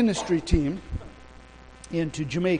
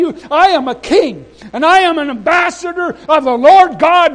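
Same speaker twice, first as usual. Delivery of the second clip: very loud, high-pitched proclamation; impassioned defiance; 8 semitones higher, 10 dB louder than usual. Retold or imitated. Preacher defying immigration minister as God's ambassador, booming defiance. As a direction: thunder back, claiming higher authority. He outranks her in heated quarrel.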